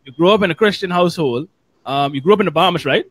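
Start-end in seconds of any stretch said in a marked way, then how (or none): none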